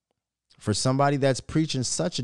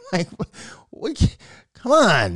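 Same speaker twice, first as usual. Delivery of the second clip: high, loud, drawn-out groan of complaint; exasperated; 9 semitones higher, 7 dB louder than usual. Real, not acted. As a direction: plead with the team in disbelief.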